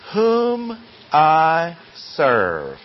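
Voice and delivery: very slow, every word of "whom I serve" held long; emphatic recitation. Shown as a demonstration. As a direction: stretch "whom", "I", "serve", stepping down to a fall. Reading verse aloud emphatically.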